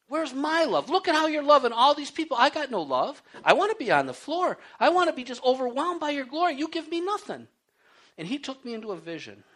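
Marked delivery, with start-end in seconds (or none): 0.1-7.5 retold complaint. Complaining to God in worship.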